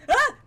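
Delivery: a sharp, high startled scream. Real, not acted. Player startled by a sudden noise, short high scream. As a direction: jump at the fright and cry out.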